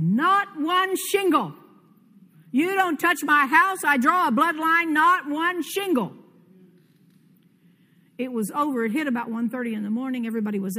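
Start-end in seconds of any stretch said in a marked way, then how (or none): 0.0-6.1 higher, louder and slow, commanding the storm; a son ordering the tornado away from his house; slow, ringing high shout of defiance; defiant shouted command. Retold or imitated.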